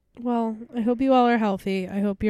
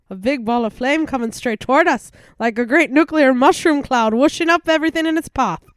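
dramatic quote reading; 4 semitones higher, 6 dB louder than usual. Shown as a demonstration. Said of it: "you wanna go ahead?".

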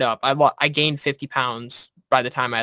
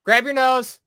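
much higher, sharp barked command; drill instructor order; 12 semitones higher, slower than usual. Retold or imitated.

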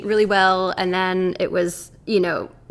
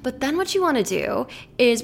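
ordinary delivery

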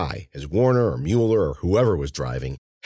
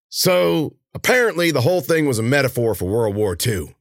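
higher, projected henchman voice; Warner, henchman; 7 semitones higher, 4 dB louder than usual. A narrator's reading. Henchman stepping from the truck mid-conversation, casually holding forth. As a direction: carry on a casual debate out loud.

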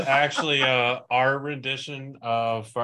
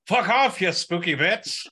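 high, shrill-free mock yell of fuck off; quoting a clip; 8 semitones higher, 4 dB louder than usual. Quoting a funny clip to co-hosts, high mock yell. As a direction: fling it at the ghost, mock outraged. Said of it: "it's just that clip".

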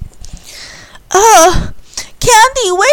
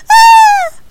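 sharp high scream of fright; frightened yelp; 10 dB louder than usual. Acted. John scared high up, a loud high shriek. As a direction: shriek in terror.

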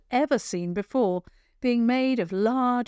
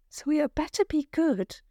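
whisper; 8 semitones higher than usual. Acted. Warning about the troll.

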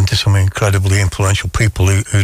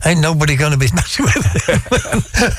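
laughing through a quote; 6 semitones higher than usual. Retold or imitated.

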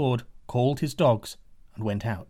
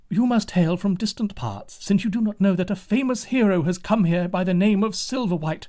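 higher, louder, eager townsperson; excited townsfolk; 6 semitones higher, 3 dB louder than usual. Acted. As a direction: tell it with excited pride. Townsfolk tell the news.

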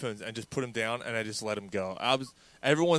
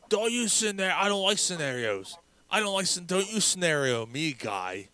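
imitating Dave; 7 semitones higher than usual. Host mimicking Dave's rant about scenarios, higher indignant voice. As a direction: sputter it like an offended friend.

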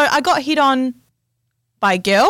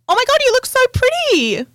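put-on much higher gushing girl voice; 14 semitones higher than usual. Girl at the pub complimenting her, high gushing voice. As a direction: gush it high like an excited stranger.

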